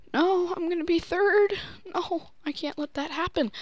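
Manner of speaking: high, mock crying whine; whiny crying. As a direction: whimper like a sore loser. Losing the lead in game.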